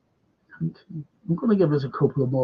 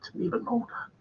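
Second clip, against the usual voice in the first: mock amazed whisper; 7 semitones higher, 12 dB quieter than usual. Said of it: "You know".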